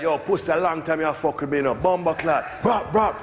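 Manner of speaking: hammed-up Jamaican rude boy menace, gunshots shouted; Jamaican gangster threat. Jamaican gunman taunting his victim face to face, mock menacing patois. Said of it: "be like".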